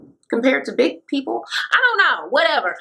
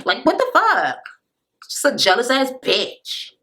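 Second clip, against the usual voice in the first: put-on voice, drawn out on the insult; imitated cast member's indignant complaint; Amanda. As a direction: do a mocking impression of her, stretching the words. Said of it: "You gotta use your Amanda voice".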